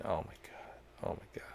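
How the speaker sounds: breathless whispered oh my God; awed whisper. Real, not acted. A gamer overwhelmed by the scene, whispering. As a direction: whisper it, moved almost to tears.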